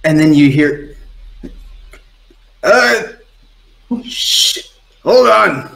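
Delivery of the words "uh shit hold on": high, grudging acted groggy reply; grumbling. Crewmate roused by the captain's call, grumbling. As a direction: grumble up from below, pitched high.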